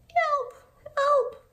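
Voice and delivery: high mock call for help; high cry for help. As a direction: squeak it out like a scared sheep. Sheep calling for help.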